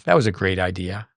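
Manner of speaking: understated sarcastic jab, imitating a player; mild retold sarcasm. Player turns to his coach after a flubbed volley, mildly sarcastic. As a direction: dryly mimic a player's sarcastic dig. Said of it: "sarcastically turns to Patrick and goes".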